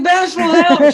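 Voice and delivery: high, loud plea for help; mock cry for help. Retelling first meeting Muggsy, she calls to Bashful in a high loud plea. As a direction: yelp for rescue, half joking. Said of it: "I was like".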